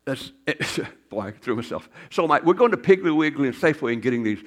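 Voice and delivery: ordinary delivery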